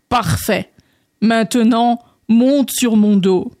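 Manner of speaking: slow, drawn-out frog voice; frog character. Acted. Frog having André climb on again, slow drawl. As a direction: stretch out the command unhurriedly.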